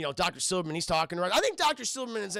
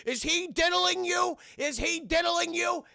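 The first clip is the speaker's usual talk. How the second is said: high, slow, drawn-out shout; hammed-up concern, stretched out loud; mock alarmed yell; 12 semitones higher, 5 dB louder, slower than usual. Bystander calls out to the kid, high mock-alarmed yell. Bystanders confront, voiced mockingly.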